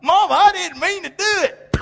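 put-on high, whiny-child excuse to mama; pleading child. Child excusing himself to mama, mock pleading. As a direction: plead like a kid caught out.

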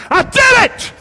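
very high, loud victory cry; triumphant shout. Acted. Preacher as Jesus calling to Dad from the cross, jubilant shout. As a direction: shout it like a son who has just won.

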